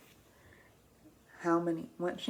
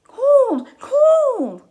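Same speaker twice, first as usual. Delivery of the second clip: high, loud, stretched cry of cold; Flap's voice; 12 dB louder than usual. Acted. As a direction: wail the words as a freezing Flap. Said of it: "says Flap".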